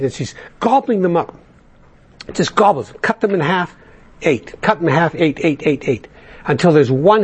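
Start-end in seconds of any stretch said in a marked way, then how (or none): none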